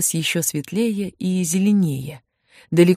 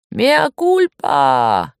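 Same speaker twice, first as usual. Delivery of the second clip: long drawn-out, raised cry; 3 semitones higher, 4 dB louder, much slower than usual. A narrator's reading. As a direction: call it out with every vowel stretched. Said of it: "и раздался крик".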